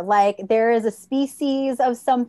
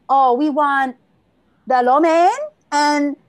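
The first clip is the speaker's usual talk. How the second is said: mock thick Chinese accent, louder and slowing; imitating an aunt's exaggerated Chinese accent; 2 semitones higher, 6 dB louder, slower than usual. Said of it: "in this thick Chinese accent".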